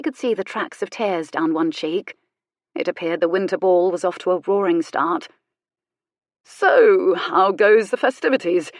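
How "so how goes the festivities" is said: lightly ironic cheer, a little louder; breezy, ironic question; cheery irony. Acted. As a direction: greet them with mock cheerfulness. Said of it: "with cheery irony".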